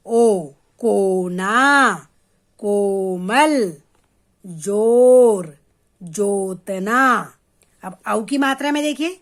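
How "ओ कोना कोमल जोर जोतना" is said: "ओ, कोना, कोमल, जोर, जोतना" very slow, with a long round "oh"; long o demo. Shown as a demonstration. Teacher showing children the o matra in "ओ, कोना, कोमल, जोर, जोतना", slow drawn-out vowel. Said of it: "ओ की मात्रा देखिए".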